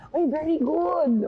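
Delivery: high, loud, slow mock professor saying "Uy, very good"; professor praising. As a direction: imitate the impressed professor, drawn out. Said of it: "ng professor ko".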